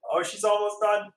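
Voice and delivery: high whooping cheer. Real, not acted. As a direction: whoop for a friend finishing. Cheering friend finishing beer.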